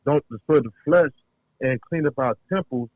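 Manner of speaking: ordinary talk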